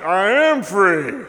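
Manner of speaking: man at the tent laughing back at the preacher, slow and loud; laughing reply. Retold or imitated. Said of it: "And he's like".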